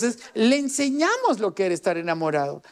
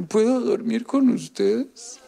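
put-on heartbroken boy; slower than usual. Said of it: "esa noche que dijo".

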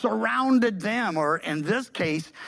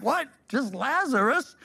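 mock disbelief; 5 semitones higher than usual. Voices the puzzled dead.

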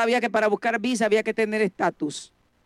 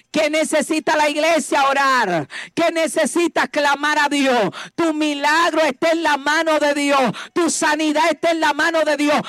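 fervent preaching; 4 semitones higher than usual. Acted. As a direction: ring it out to the church. Call and response preaching.

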